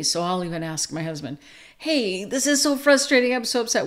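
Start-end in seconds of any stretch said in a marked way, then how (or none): 1.8-3.9 higher-pitched acted frustration; upset, frustrated. Wife venting to husband before asking him to listen, mock frustrated. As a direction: vent it, mildly exasperated. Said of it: "if, if I'm upset".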